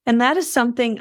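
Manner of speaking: high, squeaky voice from a cold. Real, not acted. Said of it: "I wanna apologize to everybody for my squeaky voice".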